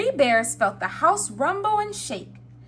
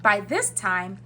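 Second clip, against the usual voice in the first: usual speaking voice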